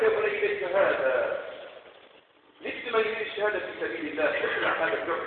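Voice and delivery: hushed mother's counsel. Retold or imitated. Aged mother tells son to remove armor.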